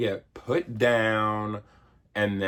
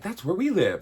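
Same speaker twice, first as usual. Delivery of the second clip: put-on kids' voice; 10 semitones higher, 4 dB louder than usual. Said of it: "And they're like".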